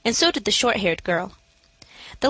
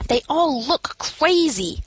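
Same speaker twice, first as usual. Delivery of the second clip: slow, higher, eerie insistence; fearful assertion; 6 semitones higher, much slower than usual. A narrator's reading. Narrator insists to the matron the women look crazy, slow and eerie. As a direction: insist they look mad. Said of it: "I asserted again".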